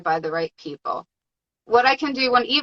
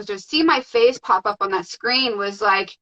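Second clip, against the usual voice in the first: ordinary talk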